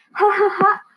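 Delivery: high storybook "ha, ha, ha"; acted guffaw. A narrator's reading. Shepherd boy mocking the fooled villagers, acted guffaw. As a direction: laugh it out as a pleased prankster. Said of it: "riéndose a carcajadas".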